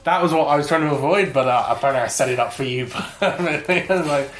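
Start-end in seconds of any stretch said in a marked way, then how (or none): none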